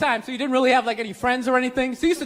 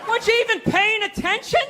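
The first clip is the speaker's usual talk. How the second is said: much higher, yelling furious dad; angry dad; 6 semitones higher than usual. Retold or imitated. Father yelling at his son, higher shout. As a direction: rip into the kid like your father. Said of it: "He looked, you".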